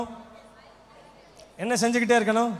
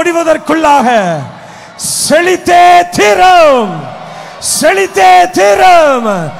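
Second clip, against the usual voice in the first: very loud, slow, higher war-cry shout; fist-raised battle cry; 4 semitones higher, 12 dB louder, much slower than usual. Shown as a demonstration. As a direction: roar it out slowly with a raised fist. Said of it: "கைய முஷ்டிய உயர்த்தி சொல்ல போறீங்க". (முஷ்டி உயர்த்திய வீர முழக்கம்)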